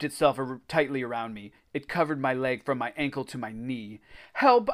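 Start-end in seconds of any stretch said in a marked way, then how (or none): 4.3-4.7 high, loud cry; yell for help. A narrator's reading. Boy yelling to Jack and Greg for help. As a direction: yell out to your friends. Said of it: "I yelled to Jack and Greg".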